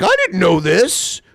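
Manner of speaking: high, loud mocking impression; squeaky fake-surprised mockery; mock G-Man. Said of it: "he'll be like".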